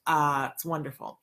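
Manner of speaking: usual speaking voice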